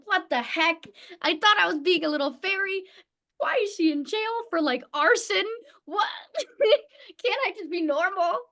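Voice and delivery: high-pitched comic disbelief; exasperated, incredulous protest played for laughs; mock dismay. Acting out dismay at dark role.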